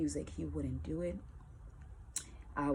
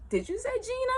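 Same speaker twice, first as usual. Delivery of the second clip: surprised question; faster than usual. Retold or imitated. Promoter surprised.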